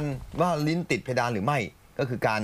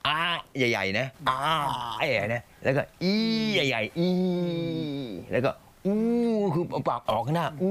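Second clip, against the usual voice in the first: slow, wide-mouthed vowel shapes; mouth-exercise demo, drawn out; big exaggerated vowels; 4 semitones higher than usual. Shown as a demonstration. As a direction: stretch each vowel with the mouth wide open.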